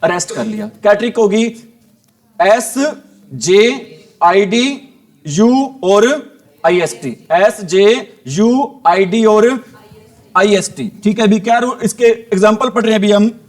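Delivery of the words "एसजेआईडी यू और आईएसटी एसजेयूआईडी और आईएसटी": very slow, spaced-out letters; drawn-out letter-by-letter recap; reciting letters. Shown as a demonstration. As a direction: recite each letter slowly for learners to memorise. Recites letters of memory trick.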